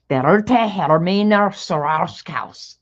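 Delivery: slow, imitated German accent on the name; thick German accent. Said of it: "she spoke with a very thick accent".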